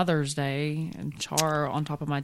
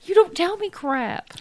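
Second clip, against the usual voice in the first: indignant outburst; 13 semitones higher, 4 dB louder, slower than usual. Real, not acted.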